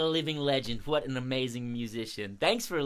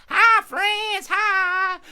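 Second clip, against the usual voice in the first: very high, loud crow greeting; crow puppet; 10 dB louder, much slower than usual. Pretend. Crow greeting the children, high shouted puppet voice. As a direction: shout hello as a little cartoon crow.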